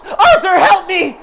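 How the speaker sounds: very high, loud terrified plea; screaming for help. A narrator's reading. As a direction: shriek his name in panic. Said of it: "She sees Joker and screams".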